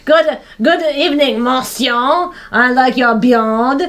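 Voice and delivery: exaggerated phony French accent; unconvincing French accent. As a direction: pitch it as an obviously fake Frenchman. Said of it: "an inconvincible French accent".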